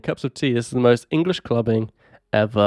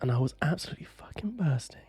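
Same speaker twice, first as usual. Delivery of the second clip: hushed whisper; whispered desperation; 4 semitones higher, 10 dB quieter than usual. Real, not acted. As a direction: drop to a whisper for the confession. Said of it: "confessing desperation".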